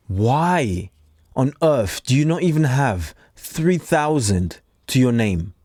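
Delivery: usual speaking voice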